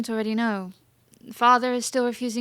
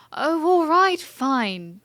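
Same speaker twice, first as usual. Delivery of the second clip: louder, higher burst of fed-up surrender; exasperated giving in; 5 semitones higher, 5 dB louder, much slower than usual. Acted. Princess caves to her pestering maid, exasperated. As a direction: throw up your hands and give in.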